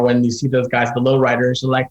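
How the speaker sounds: ordinary talk, nothing marked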